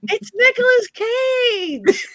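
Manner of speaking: seruan sambil tertawa, tinggi dan lebih keras; laughing exclamation. Real, not acted. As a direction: teriakkan nama sambil tertawa lepas. Laughing outburst at the vote.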